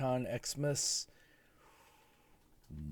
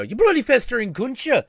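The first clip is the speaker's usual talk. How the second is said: high, loud Irish slur; imitating Rory; 9 dB louder than usual. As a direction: spit it out in Rory's brogue. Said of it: "the thing he kept saying".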